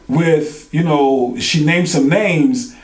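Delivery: normal talking voice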